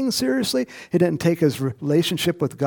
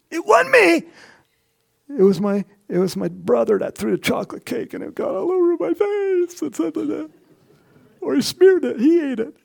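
much higher, whiny denial; whiny excuse; 10 semitones higher than usual. Retold or imitated. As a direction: play a kid blaming his brother. Sinner blaming his brother.

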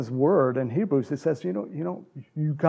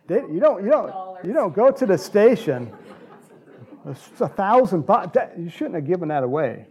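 laughing while talking; 4 semitones higher, 4 dB louder than usual. Real, not acted. Laughing at himself.

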